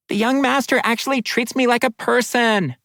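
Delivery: high, happy water spirit; bubbly spirit voice. Acted. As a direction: bubble over with delight, pitched high. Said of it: "voice bubbled happily".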